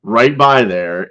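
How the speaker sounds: ordinary talk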